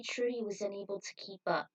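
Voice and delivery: usual speaking voice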